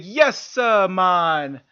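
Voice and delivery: loud, high mock Jamaican accent; eager Jamaican-accented soldier's reply. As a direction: belt it out like a Jamaican recruit. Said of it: "he had a thick ass Jamaican accent".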